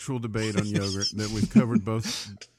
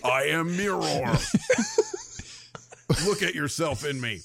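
mystic mirror voice; 4 semitones higher than usual. Pretend. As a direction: intone like an enchanted mirror.

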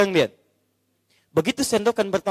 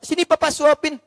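higher, brighter-voiced coaxing; playful husband's offer; 10 semitones higher, 3 dB louder than usual. Husband offering to feed his wife, high coaxing. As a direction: coax her sweetly, pitched up.

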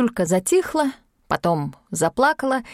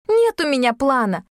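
irritated snap; 6 semitones higher, 3 dB louder than usual. Acted. Yulka snaps back at Sashka.

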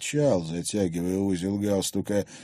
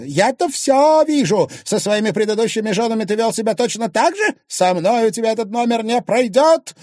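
high, loud angry accusations; furious tirade; 13 semitones higher, 8 dB louder than usual. Acted. Enraged wife warning husband, furious. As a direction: rage at him in a raised voice.

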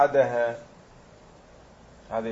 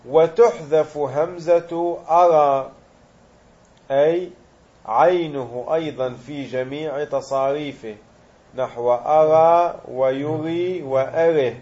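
slow, measured reading of the grammar text; reciting the text; 5 dB louder, slower than usual. Acted. Teacher reading the rule aloud to students, slow deliberate recitation. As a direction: read the rule out slowly for the class.

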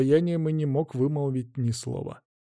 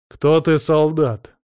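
commanding shout; 3 semitones higher, 7 dB louder, slower than usual. A narrator's reading. Prince confronts rebel soldiers.

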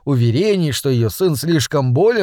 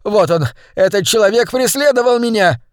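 agitated accusation; 7 semitones higher, 4 dB louder than usual. Acted. Fat man accuses pursuer.

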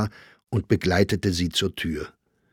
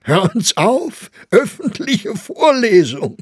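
much higher, louder, slow mocking disbelief; mocking Grossinger; 12 semitones higher, 7 dB louder, slower than usual. A narrator's reading. Grossinger mocks the idea of a public reading. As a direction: ham up the incredulity.

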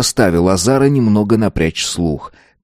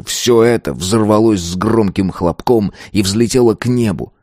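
plain reading voice

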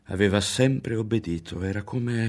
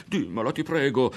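eager, higher plea; begging again; 6 semitones higher, 4 dB louder than usual. A narrator's reading. Ario pleads with Berto again to tell him. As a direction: plead once more, impatient. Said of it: "ricominciò a supplicarlo Ario".

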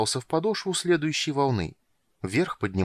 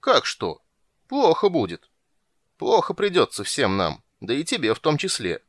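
louder, mildly gloomy grumbling; glum grumble; 5 semitones higher, 3 dB louder than usual. A narrator's reading. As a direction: grumble about the trouble ahead. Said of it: "уныло проворчал морской король". (уныло ворча)

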